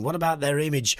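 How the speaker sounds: ordinary talk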